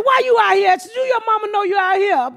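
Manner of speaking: high shouted scolding. Retold or imitated. Scolding the little girl who blocked traffic, high shout. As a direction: yell at the kid in the street, high.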